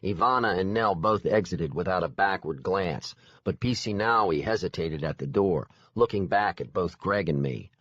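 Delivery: ordinary delivery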